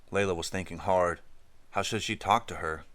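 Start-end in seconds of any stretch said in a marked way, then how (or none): none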